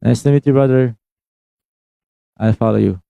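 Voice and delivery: normal talking voice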